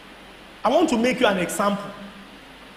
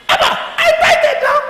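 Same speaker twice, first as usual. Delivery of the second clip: much higher, pleading complaint; whining plea; 4 dB louder than usual. Retold or imitated. Preacher whining to God that he tithed, high and pleading. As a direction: protest to God like a wronged child.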